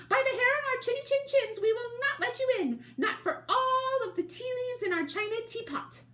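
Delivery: very high, loud tiny wolf voices; squeaky little wolves. Acted. Little wolves refusing the pig through the door, very high and squeaky.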